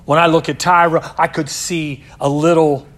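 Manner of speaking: forceful sermon delivery; loud preaching. Real, not acted. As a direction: proclaim it. Preaching climax.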